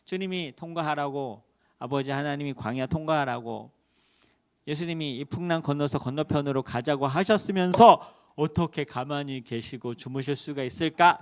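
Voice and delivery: usual speaking voice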